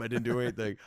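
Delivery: ordinary talk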